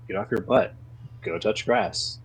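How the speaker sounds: usual speaking voice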